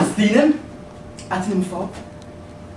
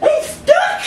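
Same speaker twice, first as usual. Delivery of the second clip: laughing squeal; 7 dB louder than usual. Retold or imitated. Retold answer laughing.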